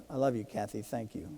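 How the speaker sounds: soft, tender aside to his wife. Real, not acted. Husband speaking to his wife in the audience, soft and tender. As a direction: say it gently, just for her.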